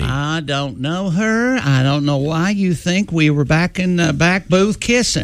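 slow, drawn-out Southern drawl; hammed-up Southern argument voice; Southern accent. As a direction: put on a Southern lover's denial. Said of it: "speak in a Southern accent".

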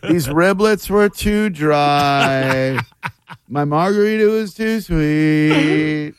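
very slow drawn-out critic cadence; slow mock restaurant review; mock food critic. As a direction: stretch every word like a bored reviewer. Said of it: "He goes in that same".

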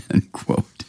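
breathy laugh through words; chuckling end quote. Real, not acted.

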